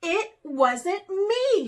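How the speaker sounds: slow, loud, high protest; angry. A narrator's reading. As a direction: insist in anger. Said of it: "she grew angry".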